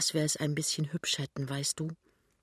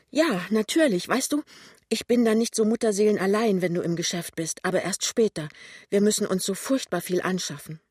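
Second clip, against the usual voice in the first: higher, livelier and louder voice; eager heroine; 5 semitones higher, 6 dB louder than usual. Acted. Lämmchen plans shared life with her fiancé, eager and higher. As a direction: chatter eagerly about the future household.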